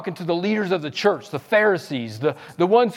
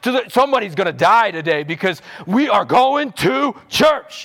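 mock angry yell; 5 semitones higher than usual. Hypocrite parent yelling at the family on the way to church. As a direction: bark at the kids like a stressed parent. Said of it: "it was".

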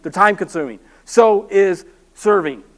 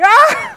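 high yelp of fright, much higher; panicked scream; 5 dB louder than usual. Retold or imitated. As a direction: shriek while bolting away. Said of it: "I'm like".

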